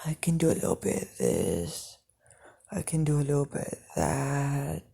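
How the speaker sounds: hint of a whispering demon; devilish voice. A narrator's reading. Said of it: "You just sound".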